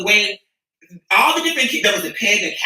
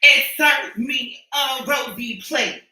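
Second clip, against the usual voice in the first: slow drawn out; 4 semitones higher, much slower than usual. Pretend. Repeated punchline drawn out.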